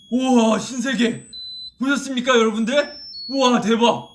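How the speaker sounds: loud, high, thrilled exclaiming; amazed wow. Real, not acted. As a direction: gush with delighted amazement. Reacting to the candle lighting up. (신나서 감탄하는 목소리)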